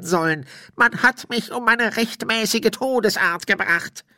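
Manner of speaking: high, put-on spirit voice, protesting; high-pitched, indignant ghostly complaint; aggrieved ghost. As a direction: plead your innocence as a wronged spirit.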